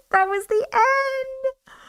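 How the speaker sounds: high, slow, stunned repeat of the ending; can't believe it's over, drawn out and high; stunned disbelief. Real, not acted. Streamer reacting to the story's sudden ending, stunned disbelief.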